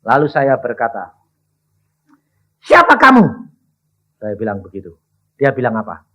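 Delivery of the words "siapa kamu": loud, high challenge; stern demand. Retold or imitated. Preacher loudly demanding the demon's name. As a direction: bark the question at the spirit.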